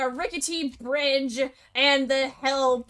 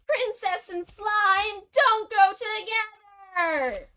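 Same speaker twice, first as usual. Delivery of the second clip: crying; 8 semitones higher than usual. Acted. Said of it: "sniffle cry sounds".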